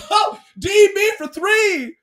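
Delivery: high, loud play-by-play shout; excited call. Retold or imitated. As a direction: call the shot like an announcer.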